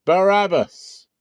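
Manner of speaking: high, loud, slow crowd cry of the name; crowd shouting. A narrator's reading. The mob shouting its choice to Pilate, high and loud. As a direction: bellow it out as a whole mob.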